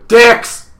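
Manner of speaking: sudden loud, high shout; blurted swear. Shown as a demonstration. Showing a friend how to reach his level, blurted shout. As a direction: blurt it out at full volume. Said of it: "occasionally just go".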